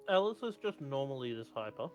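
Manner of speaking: ordinary delivery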